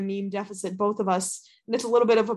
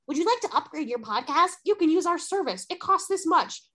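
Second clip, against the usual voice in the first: chirpy salesman voice, higher; salesy pitch voice; 6 semitones higher than usual. Retold or imitated. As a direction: sell it like a spam email. Mocking sales emails.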